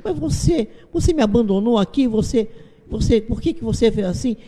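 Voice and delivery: put-on high, whiny child's voice; irritated little girl. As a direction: whine it like an upset nine-year-old daughter. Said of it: "estava muito irritada".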